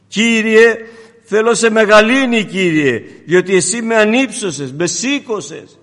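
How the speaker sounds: lifted, fervent prayer to the Lord; prayerful psalm. Acted. Preacher lifts the psalm in prayer, fervent. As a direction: pray it out loud to God.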